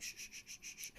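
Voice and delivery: soft whispered shushing. Retold or imitated. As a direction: hush her gently. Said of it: "I'm like".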